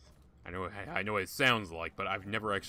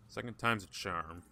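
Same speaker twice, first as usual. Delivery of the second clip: ordinary talk, nothing marked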